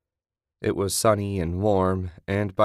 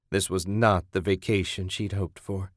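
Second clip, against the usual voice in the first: ordinary delivery, nothing marked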